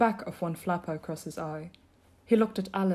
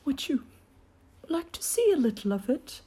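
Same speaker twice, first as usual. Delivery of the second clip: higher, hesitant creature voice; subdued high turtle voice; Mock Turtle; 6 semitones higher than usual. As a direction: offer it haltingly, pitched up. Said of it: "said the Mock Turtle".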